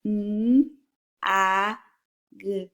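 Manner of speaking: M, A, G as three separate letter sounds; blending sounds. Shown as a demonstration. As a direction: sound out "M, A, G" one sound at a time. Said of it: "Listen as I blend the sounds".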